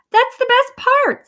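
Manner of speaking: high, loud excited protest; excited boy blurting; Jack. A narrator's reading. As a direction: squeal it out gleefully. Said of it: "said Jack".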